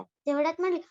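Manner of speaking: ordinary delivery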